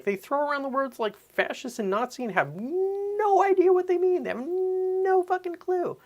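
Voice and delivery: very high, loud exasperated cry; outraged peak. Real, not acted. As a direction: let the disbelief burst out.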